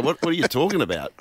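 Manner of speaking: usual speaking voice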